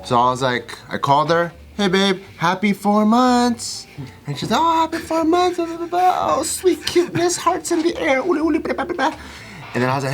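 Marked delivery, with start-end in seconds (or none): 1.8-3.9 high, drawn-out sweet greeting; cutesy boyfriend greeting. Retold or imitated. Boyfriend calling his girlfriend on their four months, cutesy. 4.5-9.2 very high cutesy babble; mock gushing girlfriend. Girlfriend answering her boyfriend's four-month greeting, mock gushing.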